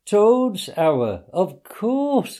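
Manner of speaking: high, bright delighted exclamation; delighted cry. A narrator's reading. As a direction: cry it out with sudden joy. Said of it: "cried the Mole delightedly".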